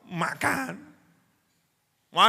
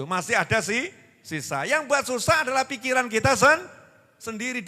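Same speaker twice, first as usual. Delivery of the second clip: ordinary talk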